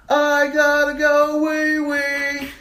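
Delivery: high, loud, drawn-out mock scream; screaming kid. Cousin acting out the boy on the slide, screaming. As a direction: screech it slowly like a kid throwing a fit. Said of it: "he's screaming at the top of".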